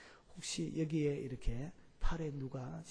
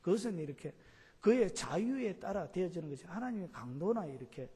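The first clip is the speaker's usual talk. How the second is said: normal talking voice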